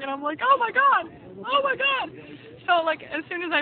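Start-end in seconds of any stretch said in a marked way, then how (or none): 0.4-2.1 high, breathless oh my God; excited disbelief. Retold or imitated. Realizing the boyfriend is proposing, high giddy disbelief. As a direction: gasp it out in giddy shock.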